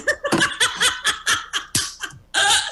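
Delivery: a loud, high burst of laughter. Real, not acted. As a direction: laugh hard at your own joke. Laughing at own headline joke.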